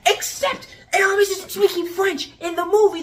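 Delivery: high, loud incredulous objection; exasperated rant. Real, not acted. Reviewer objecting to the French line, exasperated outburst. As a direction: burst out at the plot hole.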